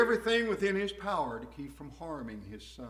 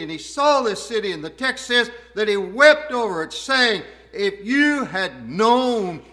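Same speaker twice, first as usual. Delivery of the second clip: rising, louder sermon voice, building passion; fervent preaching; 5 semitones higher, 10 dB louder than usual. Acted. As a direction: preach it out to the congregation with growing force. Preaching at full force.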